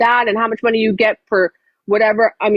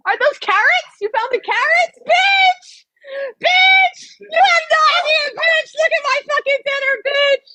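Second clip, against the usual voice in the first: high squealing shouts over the meal; delighted shrieking. Real, not acted. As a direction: scream with delight at the food. Delighted by surprise dinner.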